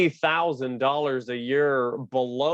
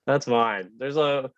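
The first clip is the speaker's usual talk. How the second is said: ordinary talk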